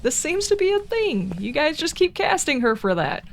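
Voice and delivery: high-pitched incredulous remark to the casters; high, drawn-up mock commentary, sliding down at the end; mock observation. Viewer addressing the people who keep casting her, mock incredulous. Acted reaction to repeated casting.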